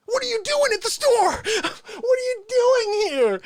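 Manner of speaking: very high, wailing mock outrage; appalled outcry. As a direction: cry out in hammed-up horror, stretching the final words. Acted outcry at coughing shopper.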